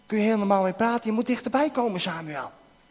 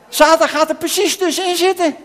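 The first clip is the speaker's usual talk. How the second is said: louder and higher, leaning hard on Satan; dramatic announcement; 9 semitones higher, 6 dB louder than usual. Acted. Preacher to the children as Satan interrupts, loud and dramatic. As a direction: proclaim it like a warning bell. Satan steps between the two.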